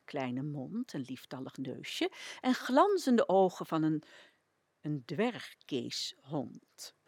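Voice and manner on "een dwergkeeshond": ordinary delivery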